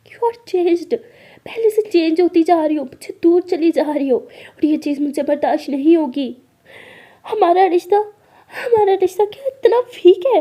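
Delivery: loud, wounded reproach; upset accusation. Acted. Maya accuses her partner of changing, loud and upset.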